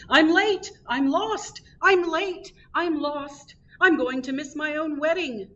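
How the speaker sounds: high-pitched panicked yell of a late groom. A narrator's reading. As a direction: call it out high while rushing past. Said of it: "he was yelling".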